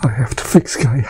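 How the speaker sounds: tearful, breaking voice; real crying as she pleads to fix Gaia. Real, not acted. Emotional regression session, crying.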